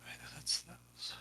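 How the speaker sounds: slow, barely voiced mutter; muttering to myself. Real, not acted. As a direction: mutter to yourself, half aloud. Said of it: "I'm muttering to myself here".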